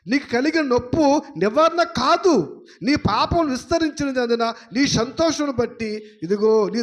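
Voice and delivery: preaching the verse at full force; fiery scripture reading. Acted. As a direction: thunder God's words at the listener. Preacher reading God's rebuke.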